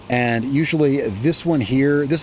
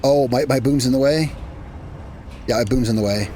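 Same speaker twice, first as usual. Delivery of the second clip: ordinary delivery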